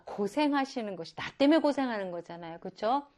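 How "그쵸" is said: normal talking voice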